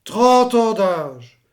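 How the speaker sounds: lent et appuyé, plus fort; traîner chaque mot, sidéré; incrédule. Acted. Achille répète, incrédule. (Achille n'en croit pas ses oreilles, incrédule)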